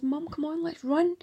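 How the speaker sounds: put-on high, urgent little girl; urgent child voice.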